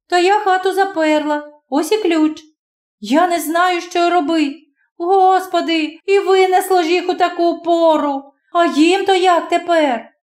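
put-on high young girl's voice, shaken by the storm; frightened girl. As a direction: tumble out the words in fright.

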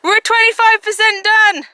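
high, loud triumphant shout; excited cheer. Real, not acted. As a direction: cheer it out to the camera. Celebrating milestone on trail.

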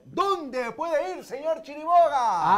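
drawn-out, high and loud; showman host call. Real, not acted. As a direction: stretch it like a radio host. Host throws cue to co-host.

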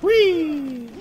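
high, drawn-out squeal; playful wheee. Real, not acted. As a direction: let out a long, falling whee. Playing, delighted in the game.

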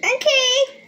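calling out. Real, not acted. Answering from the other room.

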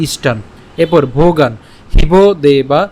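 ইষ্টান cut short, ভোগান left unfinished; slow syllable-by-syllable হিব দেবা; pronunciation demo. Shown as a demonstration. Teacher demonstrating the halant endings in the verse. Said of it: "তাহলে উচ্চারণটা কেমন হচ্ছে?".

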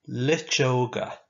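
slow, clear Irish word for the class; slow model word. Shown as a demonstration. Teacher modelling a new Irish word slowly. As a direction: drag each syllable out for them to copy. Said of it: "Now you say it after me".

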